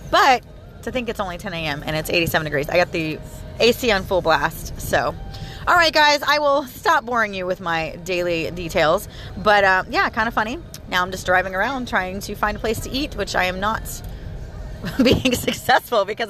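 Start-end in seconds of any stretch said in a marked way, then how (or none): none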